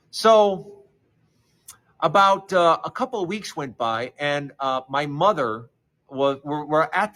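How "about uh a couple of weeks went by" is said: ordinary delivery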